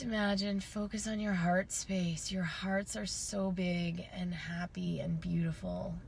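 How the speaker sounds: calm closing meditation; guided meditation. Acted. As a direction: guide them gently into stillness.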